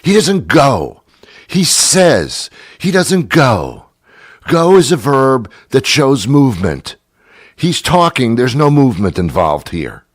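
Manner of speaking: high, slow, emphatic scolding; exasperated correction. Acted. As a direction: hammer home a grammar lesson, fed up. Corrects the storyteller's grammar angrily.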